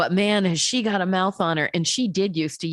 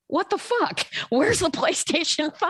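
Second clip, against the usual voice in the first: mock indignant Clooney; 11 semitones higher than usual. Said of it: "at Kimmel and goes".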